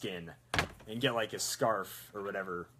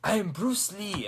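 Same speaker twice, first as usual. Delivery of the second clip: Bruce Lee; 8 semitones higher, 4 dB louder than usual. Acted. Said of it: "do a Korean voice".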